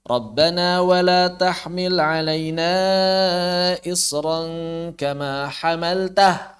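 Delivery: slow chant stopping at hamaltah; wrong stop recitation. Shown as a demonstration. Teacher showing where many people wrongly stop. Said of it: "Banyak orang berhenti di".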